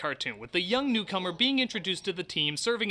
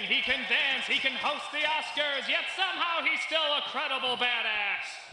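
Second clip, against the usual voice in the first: gushing praise; 8 semitones higher than usual. Real, not acted. Gushing about Hugh Jackman to his co-hosts, in a high excited shout. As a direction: rave about him like a starstruck fan.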